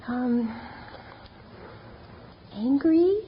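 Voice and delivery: hesitant, tentative guess rising to a question; softly unsure, testing the answer. Retold or imitated. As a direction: offer the word like a timid guess.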